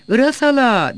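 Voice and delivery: high, loud call to someone indoors; shouted call. A narrator's reading. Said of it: "diye bağırdı bekçi". (yüksek sesle seslenme)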